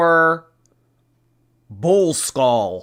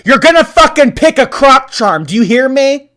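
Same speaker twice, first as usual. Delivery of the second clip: mock bossy tyrant; 11 semitones higher, 12 dB louder than usual. Forcing the audience to pick.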